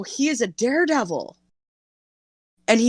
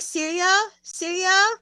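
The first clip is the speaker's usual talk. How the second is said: put-on high little-kid voice, softer, rising; cute toddler asking; 11 semitones higher, 4 dB quieter, slower than usual. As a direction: beg sweetly like a two-year-old.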